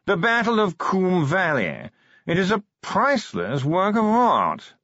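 slow, high, languid aristocratic voice; posh yawning drawl. Acted. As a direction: drawl every word like a yawn. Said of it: "modulated yawning".

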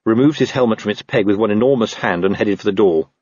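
usual speaking voice

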